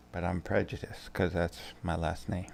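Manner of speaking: usual speaking voice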